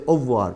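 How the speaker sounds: "Au revoir" run together as oh-vwar; fast-speech pronunciation. Shown as a demonstration. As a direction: drop the middle R in "Au revoir". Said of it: "may not hear that R".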